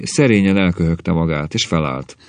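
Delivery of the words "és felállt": usual speaking voice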